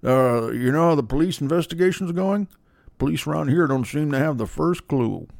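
a hint of Jamie Gumb, murmured; Jamie Gumb impression. A touch of the killer talking to Clarice. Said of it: "I'm like Jamie Gumb".

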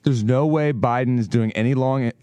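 ordinary talk, nothing marked

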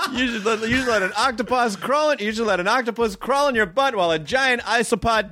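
giggling through the words; can barely hold the laugh. Real, not acted.

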